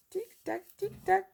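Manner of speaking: light sing-song timer; playful countdown. Pretend. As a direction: tease the viewers to hurry up. Quiz countdown.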